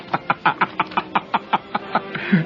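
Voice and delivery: long breathy chuckling; wheezy laugh. Real, not acted. Storyteller amused at his failed class scene, long wheezy laugh. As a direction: laugh helplessly without much voice.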